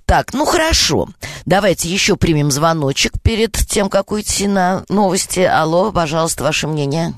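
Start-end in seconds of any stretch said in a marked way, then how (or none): none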